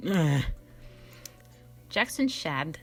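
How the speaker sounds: ordinary talk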